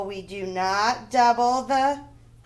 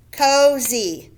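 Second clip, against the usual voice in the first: ordinary talk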